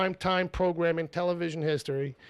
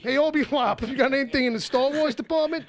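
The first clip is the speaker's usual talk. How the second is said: Brooklyn wise guy; 7 semitones higher than usual. Acted. Brooklyn character bit.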